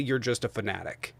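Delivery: normal talking voice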